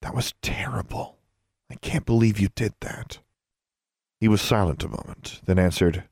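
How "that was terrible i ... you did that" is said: soft near-whisper; quiet. A narrator's reading. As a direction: hush it, shaken. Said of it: "she said quietly".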